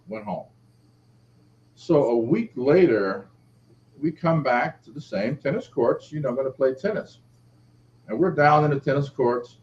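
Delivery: ordinary delivery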